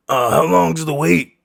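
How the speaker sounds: put-on high, loud frail old man asking. Barber imitating frail old man asking the wait.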